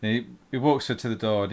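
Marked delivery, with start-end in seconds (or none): none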